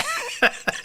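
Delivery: high, real laugh breaking out; burst of laughter. Real, not acted. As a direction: laugh at your own hot-toddy story. Laughing at the hot toddy.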